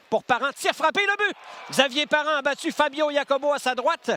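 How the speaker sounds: very high shouted goal call. Real, not acted. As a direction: shriek the goal.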